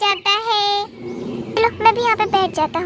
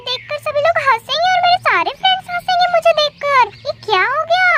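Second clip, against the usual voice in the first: high-pitched, dismayed wail; distressed whining; 7 semitones higher than usual. Acted. Boy dreading classmates laughing at him, distressed whining.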